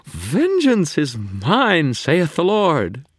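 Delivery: high, loud slow mockery; delighted faerie. A narrator's reading. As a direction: quote scripture teasingly.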